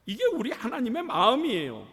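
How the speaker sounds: ordinary delivery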